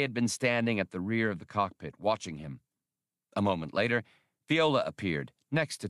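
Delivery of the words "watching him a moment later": ordinary delivery, nothing marked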